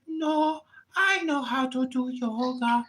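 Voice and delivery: high, whiny immigrant tenant voice; mock tenant voice. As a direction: whine like a fretful tenant. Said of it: "She's like".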